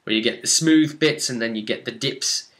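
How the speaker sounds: normal talking voice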